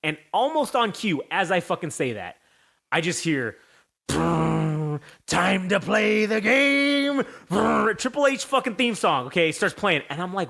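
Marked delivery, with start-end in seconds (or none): 4.1-7.2 slow booming entrance call; announcer voice. Retold or imitated. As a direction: drag out the theme intro. Triple H theme intro imitated.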